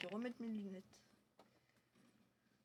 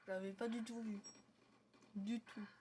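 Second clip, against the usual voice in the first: ordinary talk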